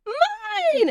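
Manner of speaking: put-on high, drawn-out toddler squeal; screaming toddler tantrum. Three-year-old cousin demanding your food, mock high-pitched scream. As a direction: imitate a small child's greedy screech. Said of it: "crying and shouting and screaming".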